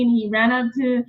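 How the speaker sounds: ordinary talk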